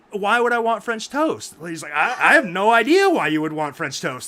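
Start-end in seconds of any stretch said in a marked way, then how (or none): 0.1-1.5 louder, higher retold protest; mock indignation. John's retold argument with the waitress. 1.9-4.3 loud mock retort. Waitress argues back, retold.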